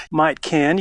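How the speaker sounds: "Might can" with stress on might, rising; dialect demo. Shown as a demonstration. Professor listing double modals for host. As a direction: offer "Might can" as a sample phrase. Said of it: "You can say might can".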